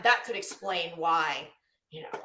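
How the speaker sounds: ordinary talk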